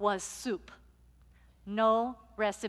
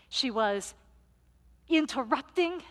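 ordinary talk

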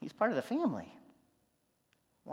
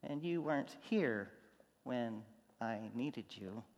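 fading softer and slower to a hurt hush; grieving reproach; 4 semitones lower, 6 dB quieter than usual. Retold or imitated. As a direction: let it trail off in quiet hurt. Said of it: "I hear Martha saying".

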